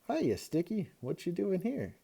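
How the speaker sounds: high, bright hello to a pet; excited greeting. A narrator's reading. Boy greeting his pet gecko, delighted.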